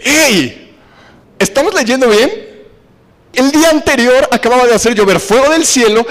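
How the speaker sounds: incredulous preacher exclaiming at the congregation; incredulous outcry. Real, not acted. Preacher challenges the audience.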